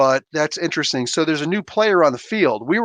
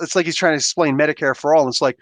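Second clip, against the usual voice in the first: normal talking voice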